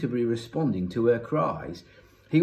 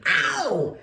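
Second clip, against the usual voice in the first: higher-pitched yell of "ow"; yelp of pain; 8 semitones higher than usual. A narrator's reading. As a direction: cry out as the cane hits. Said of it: "yelled Thwaites".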